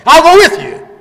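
high, loud ringing promise; booming voice of the Lord. Acted. Preacher voicing the Lord's promise to the congregation, booming. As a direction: call it out as the Lord himself.

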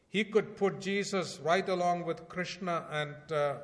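urgent sermon delivery; fervent preaching. Acted. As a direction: preach it out to the congregation. Preaching at full force.

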